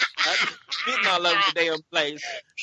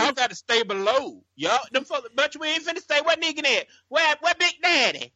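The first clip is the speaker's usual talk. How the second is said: demanding followers; 9 semitones higher than usual. Retold or imitated. Voicing followers demanding Negan.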